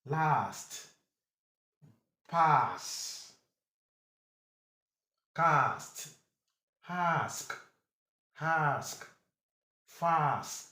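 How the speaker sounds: "Pass", "Cast", "Class" with long "ah". Shown as a demonstration. Teacher drills A S words, drawn-out "Class". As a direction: say each word alone, vowel held, as in "Last". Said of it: "mostly gives you long ha".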